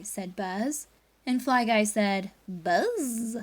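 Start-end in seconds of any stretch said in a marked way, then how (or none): 2.5-3.4 small buzzy insect voice; a pet fly saying its name; fly's buzzing voice. Acted. Fly character speaks.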